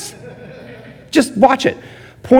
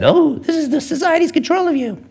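much higher, sneering parody voice; mocking paranoid friends. Friends on social media warning him, high mocking parody. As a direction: mimic the conspiracy crowd. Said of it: "all said".

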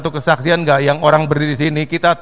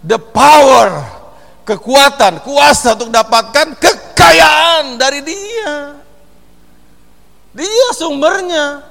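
preaching at full force; 7 semitones higher, 7 dB louder, much slower than usual. Acted.

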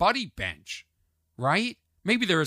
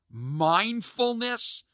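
drawn-out, higher-pitched mock question; mocking disbelief; 6 semitones higher, much slower than usual. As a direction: stretch it out in disdain. Mocks the word again.

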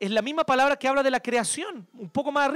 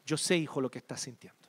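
mucho más suave y bajo, como abrazando; consuelo tierno; 7 semitones lower, 4 dB quieter than usual. Pretend. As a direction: habla bajito a un hijo que llora. Dios consuela al hijo que falló. (Dios consuela al hijo caído, voz tierna y muy suave)

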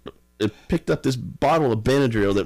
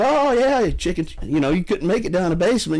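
teasing a friend who fled the basement; mocking taunt; 9 semitones higher than usual. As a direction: rib him for chickening out.

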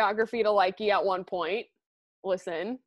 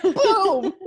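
excited; 11 semitones higher, 8 dB louder than usual. Real, not acted. Echoing the triumphant exclamation.

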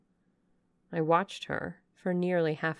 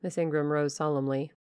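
ordinary delivery

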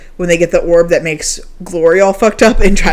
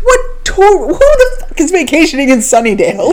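incredulous; 8 semitones higher than usual. Retold or imitated. Retold incredulous reply.

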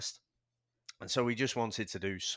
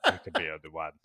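Highlander impression; 5 semitones lower, 6 dB louder, faster than usual. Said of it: "I heard the impression".

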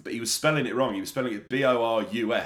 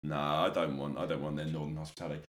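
muttered much lower and softer, fast; low grumble; 11 semitones lower, 8 dB quieter than usual. Real, not acted. About the northern lad, a low fast grumble. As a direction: grumble it under the breath.

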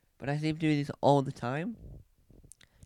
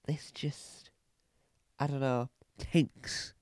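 whispering; 10 dB quieter, slower than usual. Real, not acted. Trailing off under breath.

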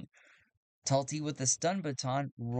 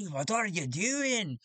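slow, higher gruff lawman; gruff sheriff; 7 semitones higher, slower than usual. Acted. Sheriff catching two brawlers, slow gruff challenge. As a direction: scold them like a stern sheriff. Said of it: "he says".

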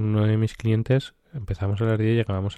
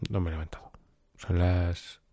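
whisper; 2 semitones lower, 6 dB quieter than usual. Real, not acted. Whispered early hour.

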